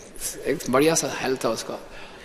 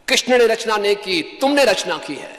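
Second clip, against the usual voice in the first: loud, raised-pitch imitated rebuke; retold shout; 5 semitones higher, 4 dB louder than usual. Guru stops and answers the disciple's question, retold shout. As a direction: throw the blame back forcefully, imitating a shout. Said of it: "Prabhupada रुके और जोर से चिल्लाए".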